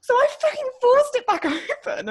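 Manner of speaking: high, loud, laughing through it; real laughter mid-story. Real, not acted. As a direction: laugh while telling the comeback. Retelling car park standoff.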